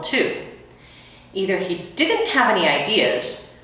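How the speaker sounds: ordinary delivery, nothing marked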